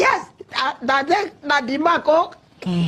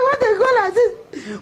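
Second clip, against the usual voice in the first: a laughing sob, higher; crying retell; 5 semitones higher than usual. Retold or imitated. Mother retelling the station scene, half sobbing. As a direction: wail it through tears.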